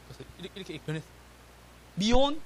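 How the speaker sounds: ordinary talk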